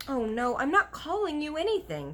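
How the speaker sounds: put-on high, urgent girl; a girl rushing to make amends; girl pleading loudly; girl crying out. A narrator's reading. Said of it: "Sophie cried".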